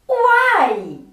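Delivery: ordinary delivery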